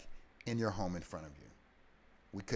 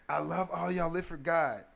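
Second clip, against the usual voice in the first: higher, louder last words, retold; imitating dying farewell; 7 semitones higher, 6 dB louder than usual. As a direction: give the farewell as she collapses. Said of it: "she's breathing hard".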